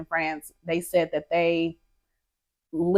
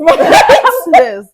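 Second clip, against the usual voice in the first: laughing; 10 semitones higher, 13 dB louder, much slower than usual. Real, not acted. Laughing, promising nap later.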